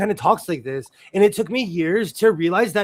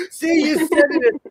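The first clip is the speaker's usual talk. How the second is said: ordinary delivery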